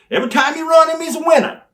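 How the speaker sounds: high and loud praise of Steve; booming promo praise; hyped-up boast. Retold or imitated. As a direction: sell the winner big. Belittling Brian in retold promo.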